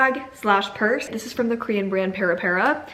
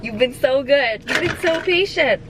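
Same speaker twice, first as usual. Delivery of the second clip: praising the dog; 5 semitones higher than usual. Real, not acted.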